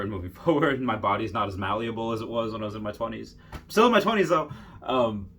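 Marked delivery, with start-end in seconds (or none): 3.5-4.5 higher, louder quick protest; mock-defensive. Real, not acted. Vlogger correcting himself about getting older, mock-defensive. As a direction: pipe up with a high, loud correction.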